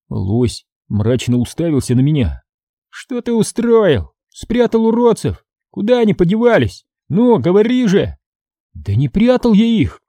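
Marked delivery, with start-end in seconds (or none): 2.9-8.2 demanding interrogation. A narrator's reading. Moose grills Joe about the missing gnomes, demanding. 8.7-10.0 protesting. A narrator's reading. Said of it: "пытался убедить его я".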